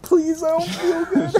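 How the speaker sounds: high, slow, fake-tearful kid whine; mock crying plea. Boy begging his mom, high mock-crying whine. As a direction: whimper like a kid about to cry. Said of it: "I'm, like, crying".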